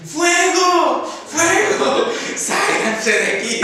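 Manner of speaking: full-lung fire alarm shout, a laugh breaking in before "Sálganse". Shown as a demonstration. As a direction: yell "Fuego" with full lungs to clear the house. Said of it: "cuando sí es bueno gritar".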